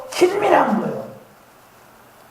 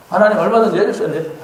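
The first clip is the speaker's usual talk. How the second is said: normal talking voice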